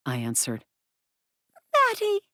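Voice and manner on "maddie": high squeak of alarm; panicked goblin girl. Acted. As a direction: squeak out her name.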